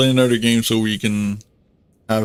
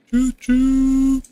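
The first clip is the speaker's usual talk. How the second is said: high train whistle call; 4 dB louder than usual. Pretend. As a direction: toot like a steam train. Train noise while rushing in.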